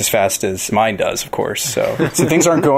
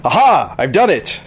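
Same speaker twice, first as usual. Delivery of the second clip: triumphant; 7 semitones higher than usual. Acted. Gleeful inventor announcing success.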